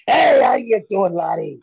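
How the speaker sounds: loud, high mock Scottish accent; hammed-up Scottish phone greeting; a joking Scottish brogue, called out. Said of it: "I'll do it in a Scottish accent".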